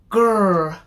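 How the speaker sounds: a loud animal growl; tiger growl. Acted. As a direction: growl like a big cat. Scary noise in the story.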